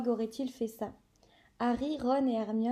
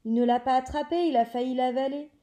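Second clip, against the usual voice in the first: loud, fast angry protest; furious yelling; 7 dB louder, faster than usual. Acted. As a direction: yell it out, fast and outraged. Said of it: "Marcus Flynn continuait de hurler".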